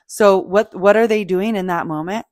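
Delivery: ordinary delivery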